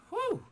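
a sharp high whew of relief; breathy relief noise; high relieved whew. Real, not acted. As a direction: blow out a high squeak of relief. Cooled by a fan.

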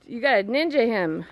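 ordinary talk

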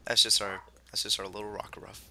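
normal talking voice

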